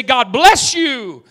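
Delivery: high, drawn-out shouted blessing; loud preached blessing. Real, not acted. Preacher proclaims blessing over culture, loud and fervent. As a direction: proclaim it at full pulpit force. Climax of preaching, then softened.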